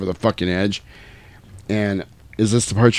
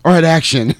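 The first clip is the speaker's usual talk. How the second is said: louder, higher mock director's call; director calling action; 7 semitones higher, 4 dB louder than usual. Director sends the actor in with no preparation, calling action. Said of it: "they just told him what to say and they went".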